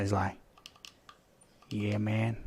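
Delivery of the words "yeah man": quiet, lightly imitated Texan drawl; a hint of a Texan sniper; Texan accent. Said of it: "Texan kind of accent".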